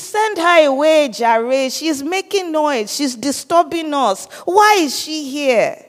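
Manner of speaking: high, annoyed disciples pleading to be rid of her; whiny disciples. Retold or imitated. Disciples grumbling to Jesus to send the woman away, high and whiny.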